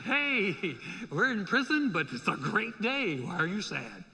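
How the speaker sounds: over-the-top happy-guy act; mock Mister Happy. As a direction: greet them with exaggerated chirpy glee. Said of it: "Mister Happy that comes in and goes".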